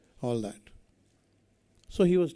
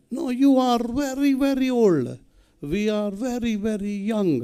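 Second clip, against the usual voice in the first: imitated European accent, high and sing-out; high mock European English; 9 semitones higher than usual. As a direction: mimic a European speaker, pitched well up. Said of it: "in his typical European accent".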